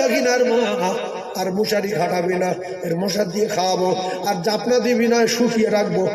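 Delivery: ordinary delivery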